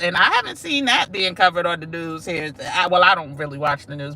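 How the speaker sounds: usual speaking voice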